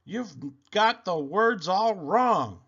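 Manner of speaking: high, loud mocking yell, then slowing; storybook jeering yell. A narrator's reading. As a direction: yell it at poor Drip in a high voice. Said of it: "but the others yelled".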